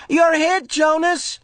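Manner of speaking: high playful yell. Acted. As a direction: call the hit across the field. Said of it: "Asher yelled".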